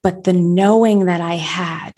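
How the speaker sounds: usual speaking voice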